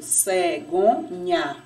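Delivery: "cegonha" slowly, a pause after each syllable; split into syllables. Shown as a demonstration. Teacher showing n and h stay together, "cegonha" in slow syllables. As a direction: sound out "cegonha" piece by piece, last NHA kept whole. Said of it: "quando a gente separa a sílaba".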